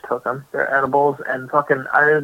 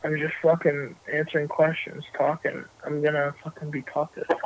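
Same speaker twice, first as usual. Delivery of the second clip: stoned; 12 dB quieter than usual. Real, not acted. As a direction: mumble along, baked and drowsy. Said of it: "I am so fucking stoned right now".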